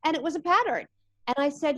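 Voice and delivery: ordinary delivery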